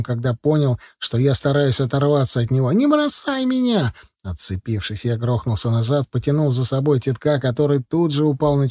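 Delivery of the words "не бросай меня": high, desperate plea; acted panic at normal volume, pitch way up; panicked cry. A narrator's reading. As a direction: cry out high, terrified of being left. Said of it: "заорал Титок не своим голосом".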